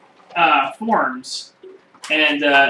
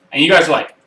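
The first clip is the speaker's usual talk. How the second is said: ordinary delivery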